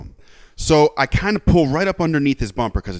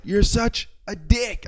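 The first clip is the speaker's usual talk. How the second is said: repeated taunt; 9 semitones higher, slower than usual. Retold or imitated. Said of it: "I just kept going like".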